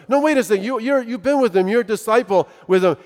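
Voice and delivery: high, mock-insistent accusation; insistent accusing woman. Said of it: "this young woman who asks".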